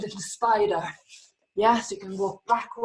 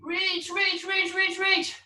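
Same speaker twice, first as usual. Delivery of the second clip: urging stretch; 5 semitones higher, 6 dB quieter, slower than usual. Shown as a demonstration. Coaching a stretch forward.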